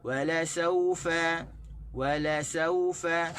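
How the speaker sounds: slow, stress on "saufa"; "Wala saufa" recited slowly with the stress on "saufa"; correct reading. Shown as a demonstration. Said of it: "sebutan kita tu salah". (bacaan betul, tekanan pada "saufa")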